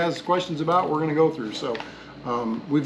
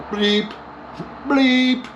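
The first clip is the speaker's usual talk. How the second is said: mock censor bleep; 8 semitones higher than usual. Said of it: "Let me start all over".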